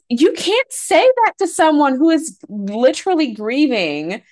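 high, loud, appalled scolding; outraged retort. Retold or imitated. Scolding her sister at the wake, high and appalled. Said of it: "I'm like".

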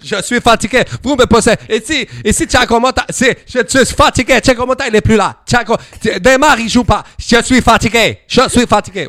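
high, loud mock German accent; hammed-up impression with a heavy German accent; Thomas Tuchel. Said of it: "il a l'accent allemand pour parler espagnol". (imitation outrée à l'accent allemand)

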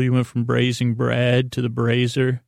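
normal talking voice

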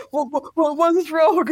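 flustered husband, high and rising into a laugh; imitating a baffled husband gabbling in a panic; panicked husband. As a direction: play the lost husband stammering, half laughing. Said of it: "my husband just thought".

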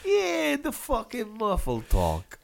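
high mock voice. Echoes mocking "muffled talk.

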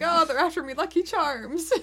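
high, lilting bad Irish accent; light hammed-up Irish accent; mock leprechaun Irish. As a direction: play a cartoon leprechaun. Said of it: "'cause it's like".

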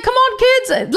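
high cartoon mascot voice; high-pitched put-on bird character; Toucan Sam. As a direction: call the kids over as a cereal mascot. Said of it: "he was like".

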